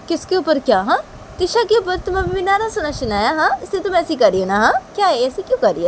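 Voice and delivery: mildly agitated mother; remonstrating mother. Acted. Tisha's mother confronts Shanaya.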